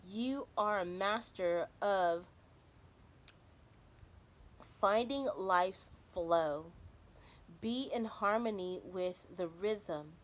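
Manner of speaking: slow, measured card reading with long pauses; oracle card reading. Acted. Reading the oracle card message to viewers, slow and solemn. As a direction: let each phrase land slowly like a message.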